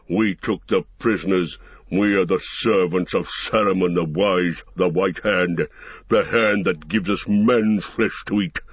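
low, deep growl of a brute orc. A narrator's reading. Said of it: "growled Ugluk".

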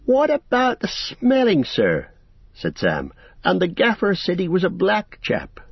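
lightly voiced humble hobbit servant; a hint of an earnest country lad; Sam's voice. A narrator's reading. Sam reminds them.

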